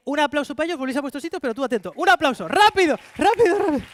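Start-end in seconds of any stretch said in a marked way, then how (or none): 1.9-3.8 hurried call. Real, not acted. Urging applause, hurrying crowd.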